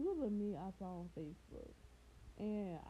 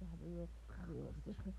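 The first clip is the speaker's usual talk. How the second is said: low, softer, quick comedian impression; Dave Chappelle; 5 semitones lower, 5 dB quieter, much faster than usual. Comedian promising his crowd a surprise, lightly imitated low voice. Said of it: "he said in his real Dave Chappelle voice".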